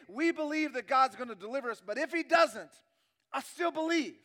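loud preacher's voice climbing to a peak; forceful preaching climax. Acted. Preacher voicing the three in the fire, rising forceful preaching. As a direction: build to a defiant declaration.

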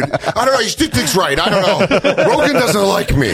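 high mock-pleading husband; defensive excuse. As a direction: plead your case with laughs creeping in. Acted husband defending himself to wife.

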